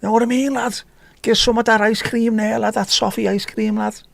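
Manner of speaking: high-pitched, hammed-up Scouse lad; playful mock Scouse aimed at a viewer; Scouse accent. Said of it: "You're talking Scouse as well there".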